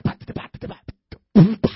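percussive syllables with a loud final burst; mock tongues as scat; mock scatting. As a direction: scat nonsense, then blast the last "bah". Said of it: "came up and kinda scatted".

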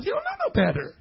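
put-on pitying baby talk. Shown as a demonstration. Preacher about drooling Bethany, pitying baby talk. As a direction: excuse a baby in a tiny high voice. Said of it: "You gotta say it like that".

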